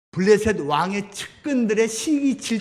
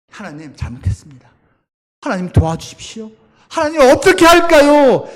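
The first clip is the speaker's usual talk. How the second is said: repentant prayer; 6 semitones higher, 8 dB louder than usual. Retold or imitated. 다윗이 무릎 꿇고 기도.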